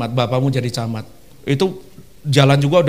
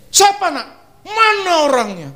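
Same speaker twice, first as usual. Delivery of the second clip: angry parent; slower than usual. Acted. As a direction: storm in looking for the bully. Parent defending a bullied child.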